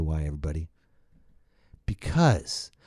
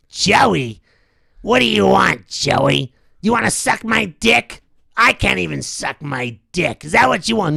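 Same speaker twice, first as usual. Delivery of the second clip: mogul voice; 6 semitones higher, 5 dB louder than usual. Retold or imitated. Weinstein answering.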